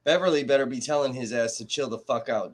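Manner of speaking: normal talking voice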